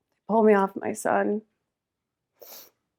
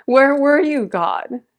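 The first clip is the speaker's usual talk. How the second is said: raised, pleading cry to God; loud, aching plea heavenward; anguished cry; 5 semitones higher, 7 dB louder, slower than usual. Real, not acted. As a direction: call out to God in real anguish. Crying out to God in grief.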